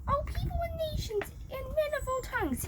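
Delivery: high-pitched herald's proclamation; herald's yell. A narrator's reading. The yell boy announcing the king's decree to all nations, high-pitched herald call. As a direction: cry it out like a royal crier. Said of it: "yelled King Nebi's yell boy at the top of his lungs".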